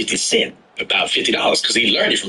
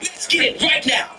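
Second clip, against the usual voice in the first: mock challenge to fight.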